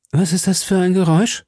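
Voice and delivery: Milady startled. A narrator's reading.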